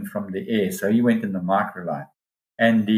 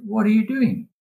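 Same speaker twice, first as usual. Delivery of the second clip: surprised; 12 semitones higher than usual. Retold or imitated. Asking the cameraman in surprise.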